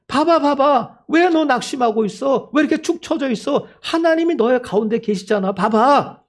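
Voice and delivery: high-pitched insistent pleading; urgent exhortation. Acted. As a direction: urge a disheartened friend to look up. Acts people urging the discouraged. (높은 목소리로 다그치듯 격려)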